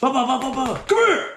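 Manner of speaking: high patter, then a yelped "come here"; imitated chase. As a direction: mimic running feet and a pursuer's shout. Acting out the chase.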